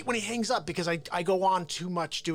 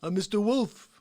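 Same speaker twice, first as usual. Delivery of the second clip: raised, insistent tough-guy introduction; Harvey Keitel; 3 semitones higher than usual. Acted. Comic as Keitel's Mister Wolf, insistent impression. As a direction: demand attention, pushing the voice up. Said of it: "how about Harvey Keitel".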